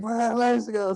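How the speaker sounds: high, stretched mock cheer; acted elation without extra volume; retold triumphant cry. Acts out character's triumphant cry.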